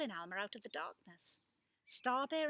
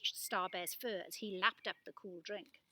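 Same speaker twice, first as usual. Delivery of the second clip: ordinary delivery, nothing marked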